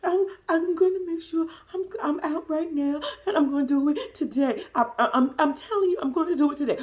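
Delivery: mock nervous girlfriend; stammering bride. Bride stammering promises on phone.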